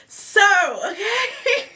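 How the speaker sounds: high cracked-up okay; giggling squeal; laughing squeal. Real, not acted.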